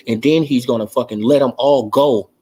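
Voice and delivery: usual speaking voice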